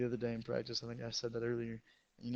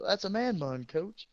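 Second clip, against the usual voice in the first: higher, louder matter-of-fact reply; teammate explaining; 7 semitones higher, 4 dB louder, slower than usual. Retold or imitated. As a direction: inform the coach. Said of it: "he goes".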